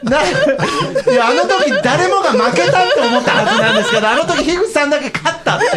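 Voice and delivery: laughing in disbelief. Real, not acted. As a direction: stammer through laughter.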